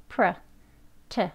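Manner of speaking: "pr" and 트 as short "pruh", "tuh"; weak schwa. Shown as a demonstration. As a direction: reduce pr and 트 to weak "uh" syllables. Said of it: "그냥 약하게". (pr, 트를 약하게)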